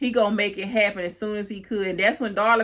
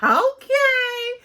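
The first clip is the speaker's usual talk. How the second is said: a much higher, louder, drawn-out "Okay"; a skeptical, knowing okay, pitched way up; sassy okay; 5 dB louder than usual. Retold or imitated. Host reacting to Dominic's line, high drawn-out sassy okay. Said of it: "And I'm like".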